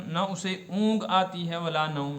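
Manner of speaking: normal talking voice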